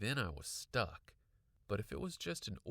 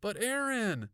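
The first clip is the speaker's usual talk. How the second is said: high, loud interjection; protesting; 12 semitones higher, 8 dB louder than usual. A narrator's reading. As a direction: cut in with an urgent protest. Girl protesting to her friend.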